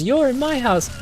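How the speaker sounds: high mock-indignant yell; high outraged woman. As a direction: shriek it like she can't believe it. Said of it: "when she goes".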